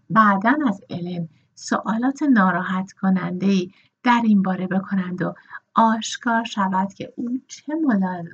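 ordinary delivery

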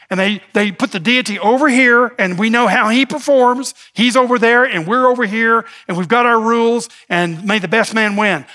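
lightly voiced self-satisfied scribes; a hint of a smug religious rule-keeper. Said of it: "That's the scribal approach".

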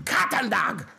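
put-on very high, emphatic Belizean-accented rebuke; dad's Belizean accent. Dad scolding fighting kids, high Belizean-accented rant. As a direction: lecture loudly in a high-pitched Belizean accent. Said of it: "impression of Dad".

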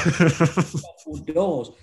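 slow, stretched out through a laugh. Real, not acted. Telling his mate about the door, laughing. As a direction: drag the words out while laughing.

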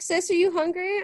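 a hint of a caring aunt asking; voicing aunt. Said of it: "She was like".

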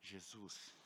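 very soft, low, hesitant; timid call. Acted. Peter timidly calls Jesus to the side, almost whispered. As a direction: barely dare to say his name.

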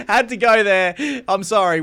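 loud, high laughing apology; sorry, not sorry, still laughing. Real, not acted. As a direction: laugh through a fake apology. Laughing after teasing.

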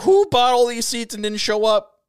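high, loud, drawn-out disbelief; mock-indignant high cry; incredulous. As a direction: exclaim it in baffled outrage. Acted disbelief at empty sold-out suite.

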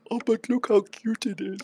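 high, doting goblin voice; cooing goblin. Acted. Goblin lifting the kitten to his face, high cooing voice. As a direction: coo over the kitten. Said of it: "he goes".

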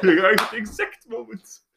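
high, slow, giggling; laughing. Real, not acted. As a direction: laugh through the line. Laughing at the quote joke.

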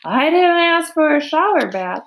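storyteller's exasperated farmer; indignant. A narrator's reading. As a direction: grumble loudly after a soaking. Drenched farmer keeps scolding his helper.

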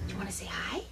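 hushed whisper. Acted. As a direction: whisper it to the child. Whispered exchange with the child.

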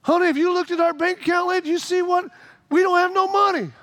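high, fast, anxious wife voice; a wife's fast, worried complaint. Retold or imitated. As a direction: rattle it off like a fretting spouse.

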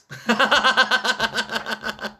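high, rapid chuckle; real giggle at your own joke; giggling laugh. Real, not acted. Laughs at own peasant noobs joke.

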